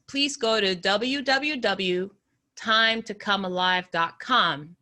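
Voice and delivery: normal talking voice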